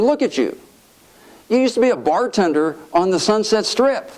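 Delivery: high-pitched, retold indignation; incredulous chiding. Congressman reminding colleague of humble past, incredulous. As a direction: imitate an astonished friend's reproach. Sonny chides his irreverent friend.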